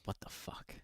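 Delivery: breathless whisper. Retold or imitated. Reading his text to viewers, whispered. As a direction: whisper the line in disbelief.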